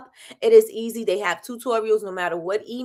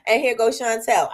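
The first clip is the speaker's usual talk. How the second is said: ordinary talk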